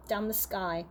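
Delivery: plain reading voice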